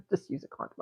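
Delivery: unvoiced, fast naughty whisper; quick hushed joke under the breath; whispered aside. Real, not acted. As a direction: slip it in as a cheeky whisper.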